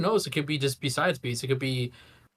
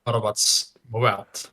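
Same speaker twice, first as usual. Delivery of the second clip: robotic mic; 2 semitones lower, 3 dB louder than usual. Real, not acted. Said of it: "you sound like a fucking Autobot".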